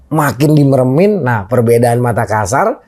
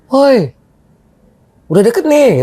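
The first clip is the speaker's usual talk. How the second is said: tiruan teriak; 10 semitones higher than usual. Retold or imitated. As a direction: imitate someone calling from afar, held back. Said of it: "Kayak ada yang teriak". (teriakan tiruan yang ditahan)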